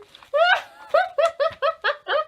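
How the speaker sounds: high, loud roaring laugh; booming hammed-up rhino laugh; big belly laugh. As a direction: laugh hard like a giant rhino. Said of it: "started laughing so hard".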